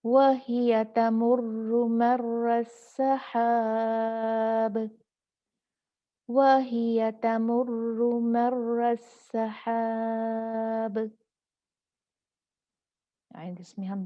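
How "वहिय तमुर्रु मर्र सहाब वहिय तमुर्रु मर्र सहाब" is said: very slow melodic recitation; Quran recitation. Shown as a demonstration. Teacher reciting the second example verse, slow chanted recitation. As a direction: draw every syllable out in chant. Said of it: "अब देखते हैं दूसरा".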